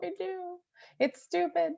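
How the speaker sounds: high-pitched embarrassed confession; sheepish squeal. Real, not acted. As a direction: squeak it out, half mocking yourself. Owning up to gushing over cat.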